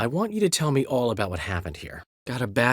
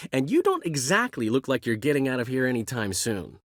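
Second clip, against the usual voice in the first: normal talking voice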